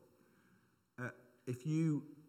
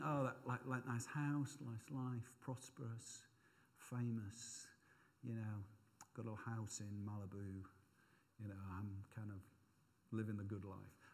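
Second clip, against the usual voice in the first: much softer, wistful dreaming; dreamy daydream; 4 semitones lower, 12 dB quieter than usual. Retold or imitated. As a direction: drift off into a luxury fantasy. Daydreaming about lottery prize.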